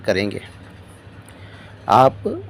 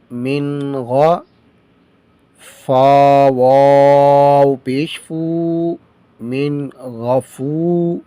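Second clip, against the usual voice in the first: slow, measured letter-by-letter recitation; slow spelling drill; much slower than usual. Shown as a demonstration. Teacher drilling students in spelling, slow recitation. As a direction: chant each letter and vowel slowly.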